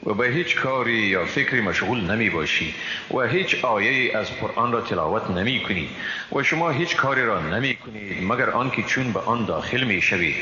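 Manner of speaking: ordinary talk, nothing marked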